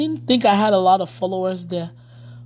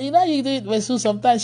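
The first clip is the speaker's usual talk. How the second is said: normal talking voice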